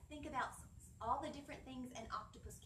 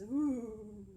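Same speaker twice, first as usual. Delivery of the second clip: spooky ooh; 6 dB louder than usual. Acted. Reacting to ghost octopus name.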